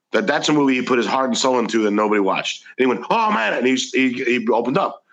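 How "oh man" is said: high, thrilled oh man; light up at the compliment; delighted exclamation. Retold or imitated. Actor hearing praise for his forgotten movie, high delighted outburst. J K Simmons opening up.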